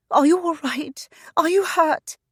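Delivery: high, urgent worried questions; anxious, rushing to him. Acted. Frantic wife checks husband.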